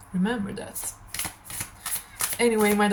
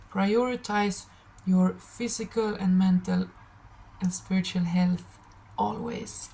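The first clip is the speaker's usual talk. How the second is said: ordinary talk, nothing marked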